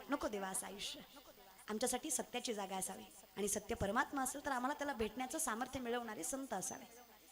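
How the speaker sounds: soft devotional plea; quiet prayer. Real, not acted. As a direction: pray to God in a hush.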